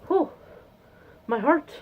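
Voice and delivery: ordinary delivery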